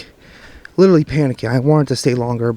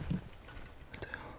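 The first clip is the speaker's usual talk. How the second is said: whisper; 27 dB quieter, much slower than usual. Real, not acted. Alone in spooky woods at night.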